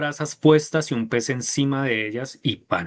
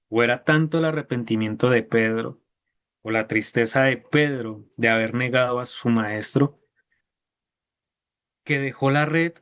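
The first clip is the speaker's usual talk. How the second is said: ordinary talk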